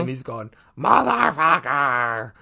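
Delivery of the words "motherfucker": slow, louder imitated outburst; mock yell. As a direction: imitate the brother cursing loudly. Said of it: "my brother screaming the F-bomb".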